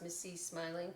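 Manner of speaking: plain reading voice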